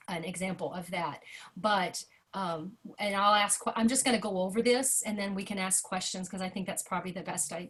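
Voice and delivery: ordinary delivery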